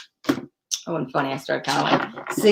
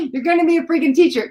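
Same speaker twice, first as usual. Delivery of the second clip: excited outburst; 9 semitones higher, faster than usual. Retold or imitated. Reader retelling her reaction to the teach card, thrilled. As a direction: burst out at the card's message. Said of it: "I said".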